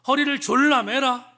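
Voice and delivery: God telling Job to answer him; voicing God. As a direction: demand it sternly from above. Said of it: "이렇게 말합니다".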